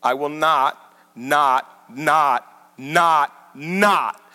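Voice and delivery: each "not" hit separately with a pause between; five punched "not"s, steady and emphatic; repeated not. Shown as a demonstration. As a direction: hammer every "not" as its own beat. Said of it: "Five times he uses the word "not".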